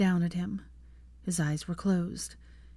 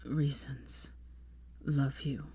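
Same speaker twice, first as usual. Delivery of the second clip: low, soft, halting words; dying man struggling on; weak, failing voice; 4 semitones lower, 9 dB quieter, much slower than usual. A narrator's reading. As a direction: murmur it low with gaps between words. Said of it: "he went on".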